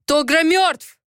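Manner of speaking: high, forceful narrated shout; angry yelling. A narrator's reading. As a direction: yell the hard truth at her. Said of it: "заорал он".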